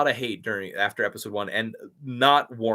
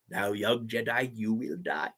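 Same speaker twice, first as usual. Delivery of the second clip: Palpatine; 6 dB quieter than usual. Acted. Acting out Palpatine with action figures, villainous. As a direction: pronounce doom slowly with quiet relish.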